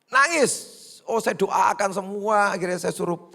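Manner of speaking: high, loud punchline word; loud exclaim. Acted. Pastor joking to the congregation, loud and teasing. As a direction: throw the word out with a grin.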